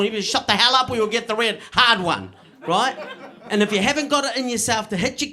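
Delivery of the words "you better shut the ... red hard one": ordinary talk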